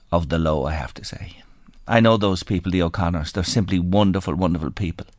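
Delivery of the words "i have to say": soft whispered words, voice nearly gone; a real shaken whisper; stunned whisper. Real, not acted. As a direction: let it fall to a whisper. Host hushed after a harrowing interview.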